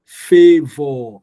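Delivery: loud "Favor" with FA stressed. Shown as a demonstration. Teacher to class modelling "Favor". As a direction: punch the first syllable of "Favor". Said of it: "I'm not to stress O R".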